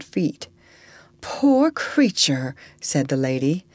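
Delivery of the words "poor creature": slow, high, airy pity. A narrator's reading. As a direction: breathe it out slowly with pity. Pitying lady over fainted woman.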